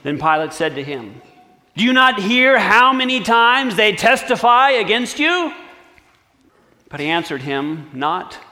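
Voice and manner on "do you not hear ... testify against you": raised, demanding governor's question; a governor losing patience, voice lifted higher and louder; Pilate's challenge. Acted. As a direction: press him hard for an answer. Pilate questions the silent prisoner.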